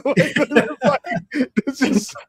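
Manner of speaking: words dragged out through real laughter; too busy laughing to finish; helpless laughter. Real, not acted. Laughing too hard to continue.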